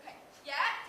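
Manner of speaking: normal talking voice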